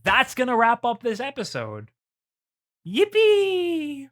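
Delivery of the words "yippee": high, bright cheer, squealed out; yippee cheer. Real, not acted. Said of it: "cheering at episode end".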